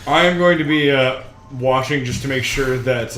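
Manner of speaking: ordinary delivery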